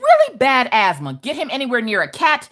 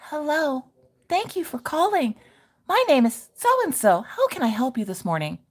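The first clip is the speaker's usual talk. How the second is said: higher, softer, sugary greeting; sweet angelic receptionist; 4 semitones higher, 5 dB quieter than usual. Retold or imitated. Imitating the angelic respiratory receptionist, higher and softer. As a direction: sound like an angel on the phone. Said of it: "if I could picture what an angel looked like".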